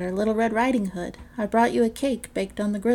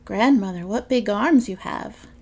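wide-eyed wonder; 7 dB louder than usual. Acted. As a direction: marvel at each strange feature. Girl wonders at grandmother.

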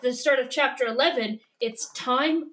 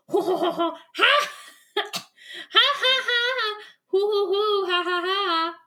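monkey calls; 8 semitones higher than usual. Retold or imitated. Retelling the jungle sounds, high monkey whoops. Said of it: "That's a monkey".

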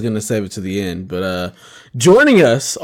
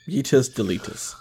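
normal talking voice

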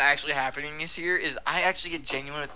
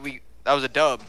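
usual speaking voice

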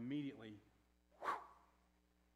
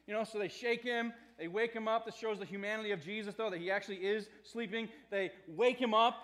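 ordinary talk

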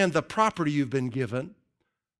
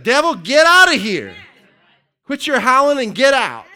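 loud, higher mock rebuke of the devil; rebuking the devil; 7 semitones higher, 9 dB louder than usual. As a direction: command the devil out like chasing off a coyote. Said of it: "She's holding her ground".

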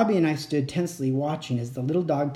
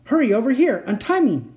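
urgent call; 8 semitones higher, 4 dB louder than usual. A narrator's reading. Tied up, calling for help.

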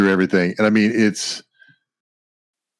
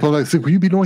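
playful high-pitched quote; high mock voice; 8 semitones higher, 3 dB louder, faster than usual. Thor voice in retold scene.

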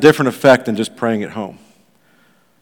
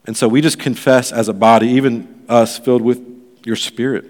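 earnest pastor's prayer to the congregation; public prayer. Acted. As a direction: lead the church in heartfelt prayer.

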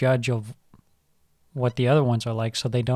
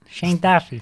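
higher, hammed-up Boston accent; mock Boston accent; 4 semitones higher than usual. Teasing a co-host with a mock Boston accent. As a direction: say it like a Boston guy. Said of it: "You're saying Boston".

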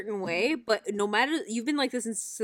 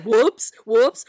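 loud mocking echo; loud, sing-song taunt; 2 semitones higher, 10 dB louder than usual. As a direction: throw it back at him mockingly. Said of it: "like, mocking him".

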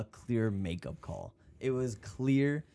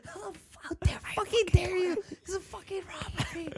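high, squeaky mock outrage; mock outraged yell. Fan at home shrieking at the TV, high and squeaky. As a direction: squeal at the robbery. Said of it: "I'm yelling".

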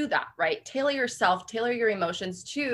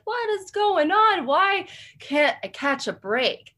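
exasperated; 9 semitones higher, slower than usual. Retold or imitated. Exasperated inner outcry.

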